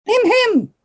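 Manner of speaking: high-pitched prim throat-clear; put-on witch's interjection; witch in pink. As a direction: squeak the two syllables, second held high. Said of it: "that ugly him".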